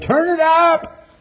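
loud, high, drawn-out holler; hollered shout. Retold or imitated. Preacher re-enacts hollering at a kid with a boom box, loud holler. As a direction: shout as loud as you can, voice flung high. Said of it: "hollered at him as loud as I could".

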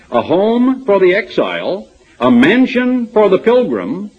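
high, slow and ringing, read like a hymn of praise; lofty reading. Acted. Preacher reading the commentary to his congregation, high and ringing. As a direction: lift the voice as if reading poetry aloud.

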